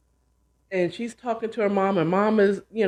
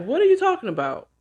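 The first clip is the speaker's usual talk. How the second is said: alarmed; 10 semitones higher, faster than usual. Retold or imitated. Alarmed question on phone.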